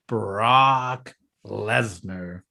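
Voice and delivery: very slow, drawn-out name announcement; imitated grand client introduction; mock announcer's intro. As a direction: stretch each word like a hype man introducing a fighter. Mimics Paul Heyman announcing his client.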